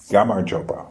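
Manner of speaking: gamarjoba in one straight, even line; even rhythm. Shown as a demonstration. Teacher showing the right take, even and level. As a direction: keep every syllable of gamarjoba level. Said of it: "one straight line".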